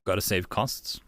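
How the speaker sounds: ordinary delivery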